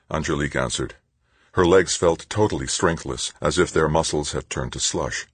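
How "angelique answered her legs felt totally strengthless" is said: usual speaking voice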